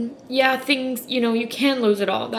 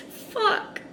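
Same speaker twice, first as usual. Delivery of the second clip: breathless tearful whisper; choked curse; 12 semitones higher, 5 dB quieter than usual. Real, not acted. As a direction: voice cracks into a whisper. Breaking down mid-story.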